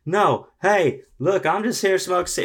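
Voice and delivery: high, loud hammed-up drunk woman. Drunk woman accosting the desk clerk, high and loud. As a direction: play the tipsy stranger barging in.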